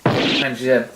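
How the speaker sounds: ordinary delivery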